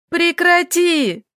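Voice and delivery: put-on girl screaming at Roma to stop; furious cry. A narrator's reading. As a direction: yell at him to stop. Furious cut-off on phone.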